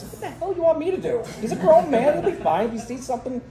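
high, exasperated protest, voice pushed up; exasperated retort. Retold or imitated. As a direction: throw the question back at her in disbelief. Retelling his exasperated reply.

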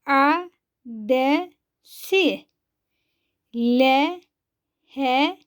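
each syllable said alone with a pause after it. Shown as a demonstration. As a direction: spell out the word slowly for pupils to repeat. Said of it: "Ben okuyorum".

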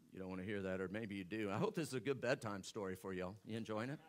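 soft, low teasing aside to the sleepy crowd; bedtime story voice. Acted. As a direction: hush it down like a bedtime story to tease them.

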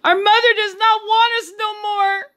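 high, loud wailing cry. A narrator's reading. Little Gretel wailing to her brother, high and loud. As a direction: wail it out like a scared little girl. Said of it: "Gretel wailed".

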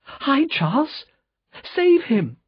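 high, trembling cry for help; terrified plea to save Charles; frightened cry. Acted. Lucy begging her father to save Charles, high and frightened.

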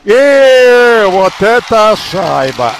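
long, slow, loud drawn-out call; excited hockey goal call. Real, not acted. Third goal called out. (протяжный громкий крик при голе)

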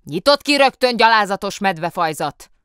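loud, high shout through a door; yelling from inside. A narrator's reading. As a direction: bellow from behind the locked cell. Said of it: "üvöltözött odabentről".

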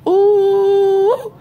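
shrill high ooh of fright; sudden scared squeal; high startled cry. Retold or imitated. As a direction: yelp it high and loud. Bug flips in tub.

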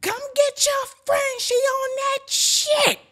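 high, very loud imitated holler; yelling downstairs. Dad's girlfriend calling to the basement about the friend, yelling. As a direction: yell it down the stairs, dragging the last words. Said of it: "my dad girlfriend yelled downstairs".

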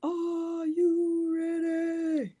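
high, slow drawn-out announcer call; hype announcer. Acted. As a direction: stretch it like a ring announcer. Hyping the peel reveal.

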